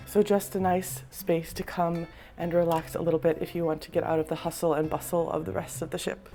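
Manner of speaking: ordinary talk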